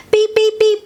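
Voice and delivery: high, quick little truck beeps. A narrator's reading. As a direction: beep high like a happy toy truck.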